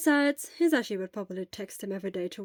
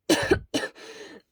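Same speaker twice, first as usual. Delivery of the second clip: harsh coughing fit; 5 dB louder than usual. Acted. Sick boy in bed hacks through a coughing fit. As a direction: cough hard, rough and ragged. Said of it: "a coughing fit interrupted him".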